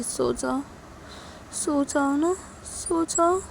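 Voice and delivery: high, coaxing bedtime voice; tender reassuring mother. Acted. Rui lulling the sick child to sleep, tender. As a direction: gently coax a child to sleep.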